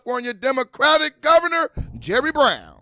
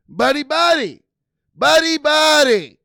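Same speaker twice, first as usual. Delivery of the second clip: higher, louder sing-song taunt; mock chummy singsong, louder on the repeat; mocking buddy-buddy; 5 semitones higher, 7 dB louder than usual. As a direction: sneer at how chummy they are. Mocks politicians as friends.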